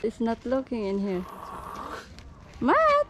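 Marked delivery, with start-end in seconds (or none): 2.6-3.1 high shouted name; calling out. Real, not acted. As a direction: yell the name across the courtyard. Calling the boy from afar.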